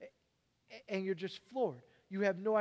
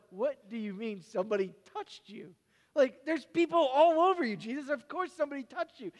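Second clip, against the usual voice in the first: incredulous disciples; 6 semitones higher, 6 dB louder than usual. Acted. Disciples scoff at Jesus.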